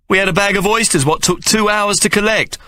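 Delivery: put-on a young lad objecting with a rough street grammar; protesting boy Hawk. Hawk protesting to Mary, high aggrieved boy voice.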